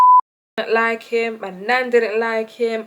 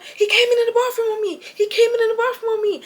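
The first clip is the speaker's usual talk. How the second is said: upset Toilet; 13 semitones higher, 4 dB louder, faster than usual. Retold or imitated. Toilet upset, accusing Tony.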